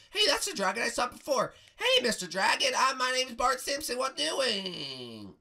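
put-on loud, high boy hailing; Bart Simpson. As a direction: call brashly in a high boyish voice.

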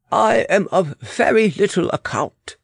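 put-on high, accented servant's voice; humble foreign attendant. Weber offering advice to royalty, humble and high.

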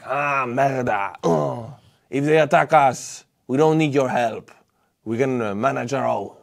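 hammed-up French accent as Macron; pirate French accent. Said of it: "sorry about the pirate French accent".

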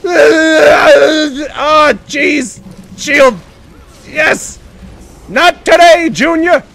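loud, high panicked yells; frantic shouting. Real, not acted. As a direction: yell through the chaos of the fight. Frantic fight in game.

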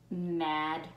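usual speaking voice

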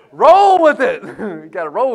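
very high, loud roll with it; hyped-up coach with a laugh; playful hype. Acted. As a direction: cheer it out, then laugh.

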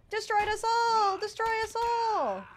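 high, slow, dramatic wail; melodramatic outcry. Pretend. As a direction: ham up the doom, drawn out. Melodramatic outcry about father.

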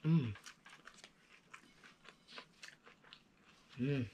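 mumbling with mouth closed; humming while eating; chewing food. Real, not acted. Seen 1: Bites sandwich at -2; lips closed, jaw working through labelled stretch.

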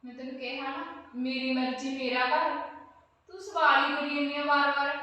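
ਗੁੱਸੇ ਨਾਲ ਉੱਚੀ ਝਿੜਕ; angry shout. Acted. Landlady loses temper with tenant. (ਕਿਰਾਏਦਾਰ ਨੂੰ ਝਿੜਕਦੀ ਮਾਲਕਣ, ਗੁੱਸੇ ਵਿੱਚ ਚੀਕ ਕੇ)

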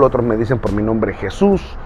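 ordinary talk, nothing marked

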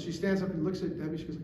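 usual speaking voice

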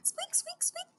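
tiny, very high soft squeaks; mouse squeak. Shown as a demonstration. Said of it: "Make some with me".

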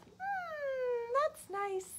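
high soft sigh of pleasure; contented pig. Acted. The pig relaxing in her bubble bath, high and soft. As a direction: sink into the bath happily.

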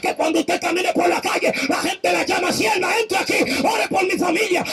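pleading preacher at full force; fervent preaching. Acted.

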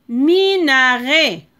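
Minaret stretched slowly syllable by syllable; slow careful reading. Shown as a demonstration. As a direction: read Minaret slowly for the children. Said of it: "On va lire aussi syllabe par syllabe".

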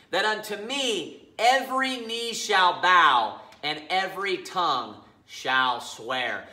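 slow, solemn divine prophecy; very emphatic. Acted. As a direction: declare each phrase slowly with weight. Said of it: "by being very emphatic".

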